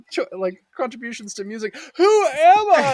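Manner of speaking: hammed-up huffy ska fan; mock indignant defense. Quoting woman defending ska.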